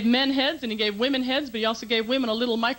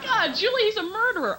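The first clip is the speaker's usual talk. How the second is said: mock gushing girlfriend; 10 semitones higher than usual. Girlfriends gushing about a prisoner.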